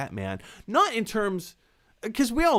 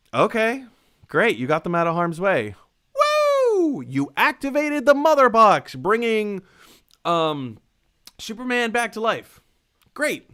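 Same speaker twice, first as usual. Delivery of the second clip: sarcastic cheer; 8 semitones higher, 6 dB louder than usual. Real, not acted.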